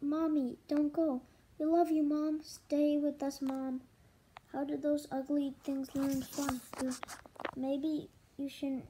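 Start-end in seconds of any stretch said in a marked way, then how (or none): 0.0-3.8 pleading shout. Acted. Tadpoles begging mom.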